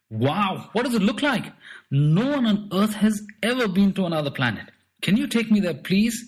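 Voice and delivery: high, loud eager rush of questions; bursting to go to space; excited. Acted. Boy begs strange man to take him there, excited. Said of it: "Zip Tucks asked excitedly".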